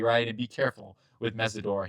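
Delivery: ordinary delivery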